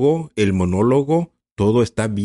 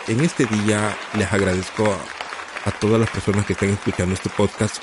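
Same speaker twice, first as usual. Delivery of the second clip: ordinary talk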